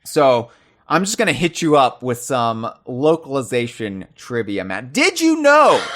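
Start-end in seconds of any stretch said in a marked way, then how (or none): 4.9-5.8 high-pitched mock-announcer shout; loud announcement. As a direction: shout it out like a trivia fanfare. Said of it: "say that loud enough".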